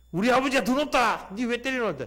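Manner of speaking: put-on high childish taunt; mock schoolboy brag, pitched high; elder's son shouting. 장로 아들이 목사 아들에게 대드는, 높은 아이 목소리. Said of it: "장로아들이 뭐라는지 압니까".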